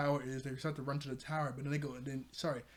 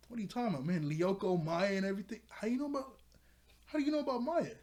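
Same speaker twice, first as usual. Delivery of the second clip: high, incredulous mock questioning; retold bewilderment; 6 semitones higher, faster than usual. Forgetful boy asks how they know Maya, in high mock disbelief. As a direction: imitate someone baffled, pitch climbing to the end.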